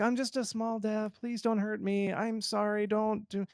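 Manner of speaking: high, soft pleading little voice; mock a nervous dev begging for mercy; mocking an apologetic indie dev squeaking an apology; timid small dev. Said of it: "I see this sometimes where it's like".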